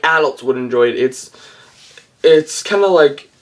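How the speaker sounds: normal talking voice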